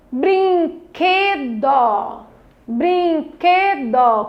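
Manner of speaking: BRINQUEDO stretched very slowly, syllable by syllable, QUE stressed; syllable stress demo. Shown as a demonstration. Teacher has children find the tonic syllable, Brinquedo slowly stressed on QUE. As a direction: draw out each syllable of Brinquedo, leaning on QUE. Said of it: "ler com maior intensidade a palavra".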